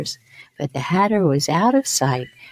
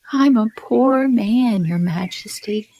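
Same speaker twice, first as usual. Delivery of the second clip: pleading Hatter; 7 semitones higher than usual. A narrator's reading. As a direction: beg on one knee. Hatter kneels begging the king.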